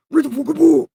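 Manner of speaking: high, loud alter-ego outburst; unhinged high-pitched command; split-personality order. Acted. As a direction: shout it in a warped other voice. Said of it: "This seriously giving me split personalities".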